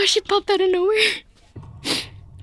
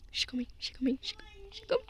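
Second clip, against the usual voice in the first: frightened whisper; 2 semitones higher, 15 dB quieter, much faster than usual. Real, not acted. Hiding from Granny nearby.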